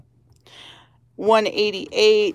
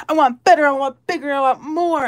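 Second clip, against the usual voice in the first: greedy outburst; 4 semitones higher, faster than usual. Acted. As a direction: burst out wanting more. Greedy wish whispered then shouted.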